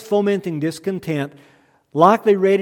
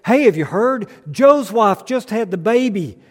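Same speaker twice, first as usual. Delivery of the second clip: higher, louder, bursting with good news; excited; 4 semitones higher, 4 dB louder than usual. Acted. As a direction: blurt it out like the best news of the week. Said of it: "all excited and says".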